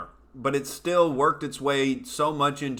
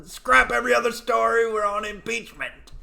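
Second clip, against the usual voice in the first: high, loud mocking orders; mock screaming; 10 semitones higher, 4 dB louder than usual. Jeff Zucker ordering staff about impeachment, loud mock yell. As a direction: bark out the orders like a panicked boss. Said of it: "up there screaming about".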